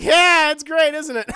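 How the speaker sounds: very high, loud strained reply; dad to Esther, loud nervous cheer; awkward forced cheer. Retold or imitated. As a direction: laugh it off nervously.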